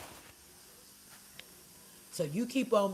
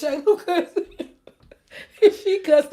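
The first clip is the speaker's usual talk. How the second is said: high, loud laughing retelling; cracking up while telling the story; 11 semitones higher, 11 dB louder than usual. Real, not acted. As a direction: laugh through the words. Laughing retelling Shayla cussing.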